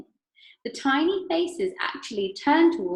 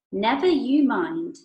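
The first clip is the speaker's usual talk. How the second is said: slow, louder put-off; Wonka teasing; 4 semitones higher, 7 dB louder, slower than usual. Acted. Mister Wonka teasing Veruca, slow and louder. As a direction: keep it secret, playfully.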